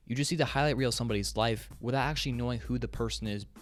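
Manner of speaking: normal talking voice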